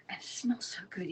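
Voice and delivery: hushed, savoring the smell; whispered delight. Real, not acted. Whispering to viewers about the tea's smell. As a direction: whisper it over the tea. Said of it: "smelling the tea".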